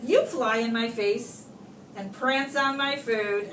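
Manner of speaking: ordinary delivery, nothing marked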